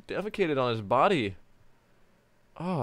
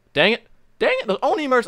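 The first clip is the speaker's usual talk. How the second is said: much higher and louder, yelling; frustrated shout; 13 semitones higher, 10 dB louder than usual. Shown as a demonstration. Actor yelling the line in frustration at his own flubs. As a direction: blow up at the botched line.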